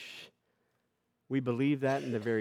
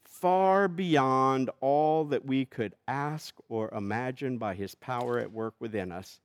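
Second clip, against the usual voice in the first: raised, ringing doxology read aloud; scripture reading; 3 semitones higher, 5 dB louder than usual. Acted. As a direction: proclaim it like a blessing. Reads Paul's doxology aloud.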